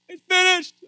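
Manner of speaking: loud, high shout; proclaimed cry. Acted. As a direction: cry it out as a final declaration. Acts the cry from the cross.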